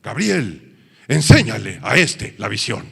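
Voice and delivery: emphatic, forceful order to an archangel; commanding cry. Acted. God commanding the archangel Gabriel, voiced as a forceful cry. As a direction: call it out like God's command. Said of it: "que dio un grito".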